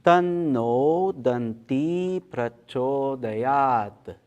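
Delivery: slow intoned Sanskrit chant, vowels held long; leading mantra recitation. Shown as a demonstration. Teacher leading the group through the Ganesha Gayatri, slow mantra recitation. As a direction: intone each phrase slowly, leaving room to join in. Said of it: "let's try to do that together".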